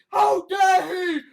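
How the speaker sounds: high, loud sarcastic shout; mock outraged shout. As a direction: bellow it like a hysterical critic. Mocking outraged critics.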